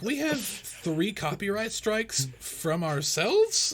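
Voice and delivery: high, slow, loud disbelief; baffled disbelief. Retold or imitated. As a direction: stretch it out, stunned. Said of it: "they're like".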